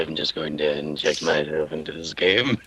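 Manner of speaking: low, hammed-up intruder voice; put-on character voice. Player butts into the game as a joke, in a low put-on voice. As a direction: drop your pitch and play a silly character.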